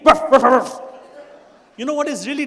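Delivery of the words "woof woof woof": loud barking call; wife barking her husband in for dinner, very loud. Retold or imitated. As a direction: bark it out like calling the dog.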